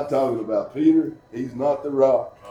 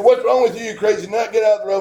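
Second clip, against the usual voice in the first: higher, louder yell of annoyed drivers; angry drivers yelling; 8 semitones higher, 5 dB louder, faster than usual. Retold or imitated. Drivers yell at the man waving his arms, angry shout. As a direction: shout at the nut in the road. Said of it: "And they said".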